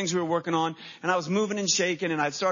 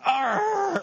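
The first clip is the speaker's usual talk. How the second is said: exasperated dad's cry; frustrated growl; 13 semitones higher than usual. Retold or imitated. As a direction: let out a burst of frustration. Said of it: "I'm just frustration".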